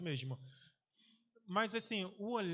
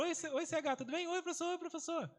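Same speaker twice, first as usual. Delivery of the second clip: much higher, chirpy chorus of hellos; eager pupils; 10 semitones higher than usual. Retold or imitated. Teacher imitating many students greeting him eagerly, high. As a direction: pile on the eager kid greetings. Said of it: "Aí começaram".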